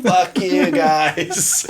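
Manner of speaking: long drawn-out gloat through laughter; mock taunt. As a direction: crow it slowly at the losers, laughing. Taunts bus riders from the Uber.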